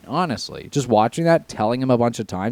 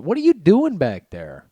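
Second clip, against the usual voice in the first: higher, louder call across the room; pitched-up, raised-voice question to the cat; 9 semitones higher, 6 dB louder than usual. Real, not acted. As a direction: break off to question a misbehaving pet. Calls out to the cat.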